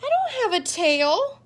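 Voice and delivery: put-on high, bright child protest; a kid insisting he has no tail. Reader voicing the child who insists he has no tail, high and indignant.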